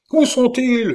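very high, loud yell; howling. A narrator's reading. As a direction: howl for the enemy. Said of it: "hurla Schomberg". (hurlement aigu et furieux)

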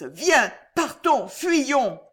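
high, urgent plea to flee; panicked woman urging escape; panting urgency. A narrator's reading. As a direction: beg him to run, pitch rising. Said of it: "haletait Alice".

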